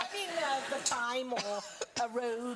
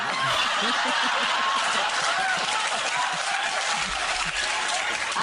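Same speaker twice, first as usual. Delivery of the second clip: big laugh; 5 dB louder than usual. Real, not acted. Laughs mid breast story.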